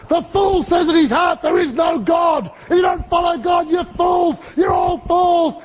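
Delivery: high, strained preacher's tirade; yelling back. Retold or imitated. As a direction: shout condemnation over a rowdy crowd. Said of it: "starts yelling back at them".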